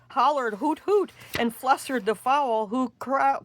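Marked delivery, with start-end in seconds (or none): 0.6-1.1 higher hooting owl. Acted. The outraged owl hoots back, pitched high. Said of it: "who hollered".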